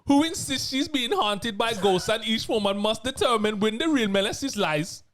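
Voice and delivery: put-on synopsis read in a Bajan accent. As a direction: Barbadian lilt, slow at the end. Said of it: "I can try a Bajan accent".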